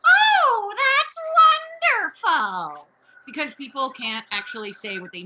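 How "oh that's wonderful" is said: much higher, louder, slow hammed-up gush; mock-delighted, stretched out and shrill with glee; gushing Mrs Garrett. Missus Garrett gushing at Mister Parker's bad news, hammed-up delight.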